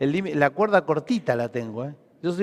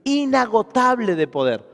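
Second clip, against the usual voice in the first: raised, emphatic sermon delivery; preaching; 4 semitones higher, 4 dB louder than usual. Real, not acted. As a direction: preach with force, leaning on key words. Preaches to congregation. (prédica enérgica y enfática)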